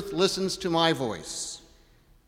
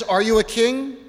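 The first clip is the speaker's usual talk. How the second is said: Pilate's question; 7 semitones higher, 5 dB louder than usual. Acted. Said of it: "He just simply says".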